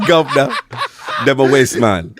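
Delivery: laughing fake London slang; mock Cockney. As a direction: banter in put-on Cockney.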